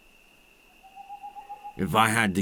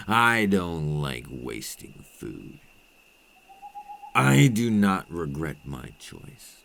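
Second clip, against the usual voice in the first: normal talking voice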